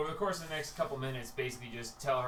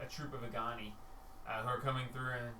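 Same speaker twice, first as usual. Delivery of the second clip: normal talking voice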